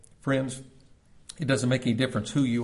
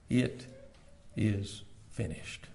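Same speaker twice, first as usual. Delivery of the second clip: hushed last words; 4 semitones lower, 9 dB quieter, much slower than usual. Retold or imitated.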